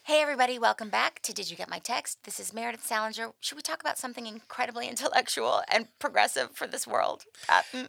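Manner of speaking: soft, demure show intro; host on best behaviour for an important listener; polite host. Pretend. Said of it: "As if Obama's listening, okay?".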